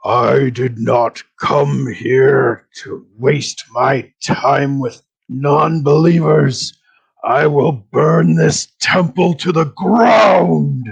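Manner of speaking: slow, loud, booming threat; thunderous war cry, slow and menacing; angry yell. Acted. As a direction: roar it out at the unbelievers. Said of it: "you hear him yell out".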